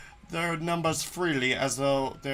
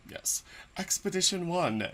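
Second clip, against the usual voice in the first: ordinary talk, nothing marked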